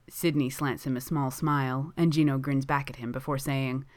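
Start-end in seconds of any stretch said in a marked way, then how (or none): none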